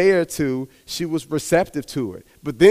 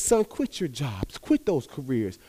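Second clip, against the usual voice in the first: slow soft command; 6 dB quieter, slower than usual. Acted. God's command.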